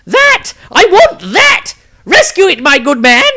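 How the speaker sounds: high, loud wailing character voice; Maestro shouting. Acted. Maestro shouting to Tordrad from ringside. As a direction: yell across the ring in a shrill put-on voice. Maestro points, demanding the trumpet.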